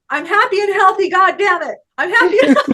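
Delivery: high, loud, exuberant declaration; retold car shout. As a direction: belt it out, breaking into laughter. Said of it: "Say the words".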